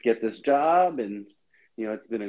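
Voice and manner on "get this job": retold excitement. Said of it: "I was so excited 'cause I'm like".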